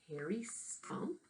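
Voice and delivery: ordinary delivery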